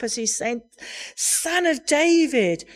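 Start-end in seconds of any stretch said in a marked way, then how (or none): 1.1-2.6 calling out. Retold or imitated. Blind man crying out.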